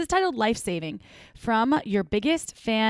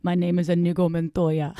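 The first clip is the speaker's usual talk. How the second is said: low, put-on foreign accent; Inigo Montoya; 4 semitones lower than usual. Host joking about the accent, low put-on accent. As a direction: quote the film line in a mock accent. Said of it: "What is that accent?".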